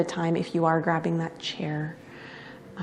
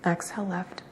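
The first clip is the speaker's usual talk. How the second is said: soft, unhurried yoga-teacher cues; guided breathing meditation; 10 dB quieter than usual. Real, not acted. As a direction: guide each breath quietly, with long pauses between cues.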